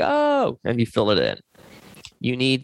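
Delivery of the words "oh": sudden realization. Retold or imitated. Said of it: "you're like".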